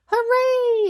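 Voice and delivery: high, loud hooray; gleeful shouted cheer. A narrator's reading. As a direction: cheer like a whole class. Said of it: "everyone cheered".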